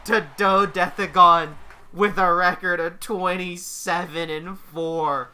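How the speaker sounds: slow, weighty announcement of the winner; slow dramatic call. Acted. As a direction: let each word land. Match ends, dramatic verdict.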